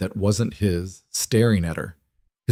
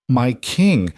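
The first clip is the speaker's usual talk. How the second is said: raised, appealing address; hesitant protest; 4 semitones higher than usual. A narrator's reading. Dismissed healer hesitates to leave, hesitant protest. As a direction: appeal with a touch of protest, pitched up. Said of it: "Kael hesitated".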